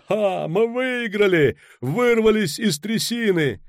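high, drawn-out victory shouts; exultant, stretched-out cheering; jubilant yell. A narrator's reading. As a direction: crow with triumph at the top of your range. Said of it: "орал Кобин".

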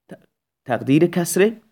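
ordinary delivery

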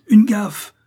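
loud, high cry for a tool; urgent shout. A narrator's reading. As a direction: shout it out in alarm, pitch way up. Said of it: "cria Korzoff". (cri pressant, fort et aigu)

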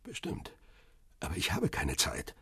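soft, muttered phone reply; hushed brush-off. Acted. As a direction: brush the caller off quietly. Hiding man brushes off caller.

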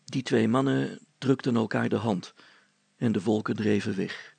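plain reading voice

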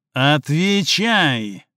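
slow, drawn-out angry order; Слик ordering the dog-man, yanking chain; harsh command. Acted. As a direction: bark the order down at him.

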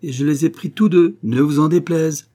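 proud swaggering boast at the dinner table; cocky boast. A narrator's reading. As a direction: crow it like a young rooster. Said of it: "en me redressant comme un jeune coq".

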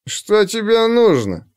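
put-on high, slow, tongue-tied drunk; slurred drunk speech. A narrator's reading. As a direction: slur it like a drunk. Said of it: "спросил он заплетающимся языком". (заплетающимся языком)

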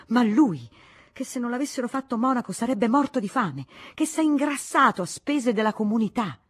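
loud, high ranting insults; furious tirade. Acted. Spinster raging at the monk's greed, loud and high. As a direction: rail against him without pause. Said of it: "gridò la zitellona".

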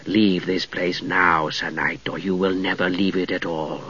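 very slow, low ghostly threat; cold hissing layered whisper. A narrator's reading. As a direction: menace him like a chill spirit. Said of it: "said the spectre".

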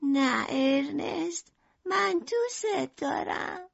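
high, soft, slow lovelorn voice; tender confession. A narrator's reading. Merlin telling Ernest she loves him, tenderly. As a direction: breathe out the love slowly, high and soft.

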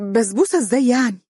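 quick, high-pitched confused question; unsettled and bewildered; flustered. A narrator's reading. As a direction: ask in a fluster, rushed and lifted. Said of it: "قالت عبير باضطراب".

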